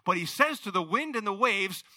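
ordinary talk

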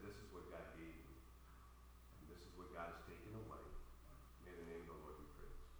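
very soft, low and quick; hushed, solemn reverence. Retold or imitated. Preacher retelling a husband's response to his wife's sacrifice, hushed and reverent. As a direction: barely voice it, like a private prayer.

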